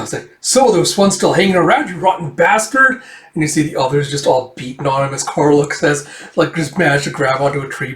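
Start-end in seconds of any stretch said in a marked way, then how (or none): 0.4-3.0 raised-pitch confrontation; acted indignation, pitched up; angry discovery. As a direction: round on the intruder. Pursuers find another intruder.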